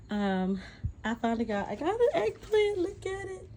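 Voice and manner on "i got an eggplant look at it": much higher, bursting with excitement over the eggplant; thrilled squeal. Real, not acted. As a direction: show her the first eggplant, delighted. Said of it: "I'm so excited".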